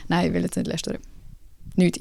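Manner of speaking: usual speaking voice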